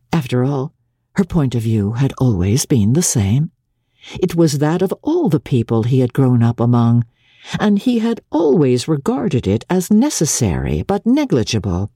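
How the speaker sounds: plain reading voice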